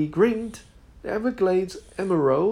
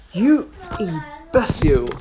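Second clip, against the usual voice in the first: louder, scornful snap; angry insult; 6 dB louder than usual. Acted. Robert snaps at Nigel over the whistle, scornful and loud. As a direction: bite his head off.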